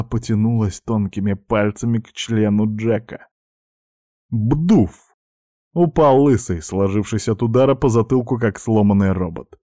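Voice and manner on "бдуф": punchy, higher-pitched spoken impact; imitated thud. A narrator's reading. Narrator sounding a blow to the bald thug's head, punchy thud. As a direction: voice a cartoonish whack.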